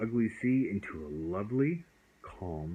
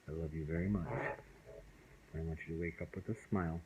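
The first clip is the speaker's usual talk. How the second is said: much softer, tender near-whisper; hushed goodnight; 4 semitones lower, 8 dB quieter, much faster than usual. Real, not acted. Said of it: "bedtime whisper to child".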